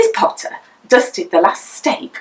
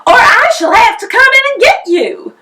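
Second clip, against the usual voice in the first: much higher, loud warning; mother threatening to climb in after her child; threatening shout; 14 semitones higher, 7 dB louder than usual. A narrator's reading.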